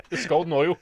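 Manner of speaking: laughing through the words. Real, not acted. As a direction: giggle it out. Laughing joke.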